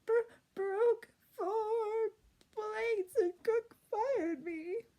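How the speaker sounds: high, loud, slow sobbing; crying. A narrator's reading. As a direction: blubber it through tears. Princess crying about being fired.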